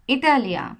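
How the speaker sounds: ইতালিয়া with i said as ই; Spanish pronunciation. Shown as a demonstration. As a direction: give ইতালিয়া its Spanish ই vowel. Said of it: "আইকে ইতালিয়া করতেছি". (Spanish উচ্চারণে ইতালিয়া)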